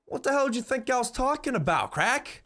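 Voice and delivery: high incredulous scoff. Real, not acted. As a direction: scoff at the idea, voice jumping high. Incredulous aside about her smoking.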